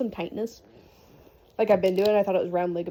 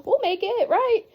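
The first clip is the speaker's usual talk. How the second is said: very high, sing-song mock reassurance; retold nervous reassurance; 9 semitones higher than usual. Labouring wife calming her freaking-out husband, nervous reassurance. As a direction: squeak out a hopeful comfort, half convinced.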